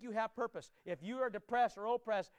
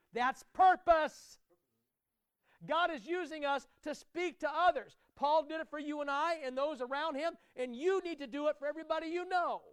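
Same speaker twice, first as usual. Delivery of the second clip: louder, higher, rousing sermon climax; full-force preaching; 5 semitones higher, 5 dB louder than usual. Acted. Pastor rousing the congregation, at full force. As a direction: preach it with mounting fire.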